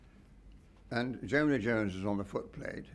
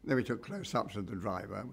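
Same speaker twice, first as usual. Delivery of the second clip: ordinary talk, nothing marked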